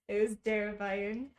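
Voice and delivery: ordinary talk, nothing marked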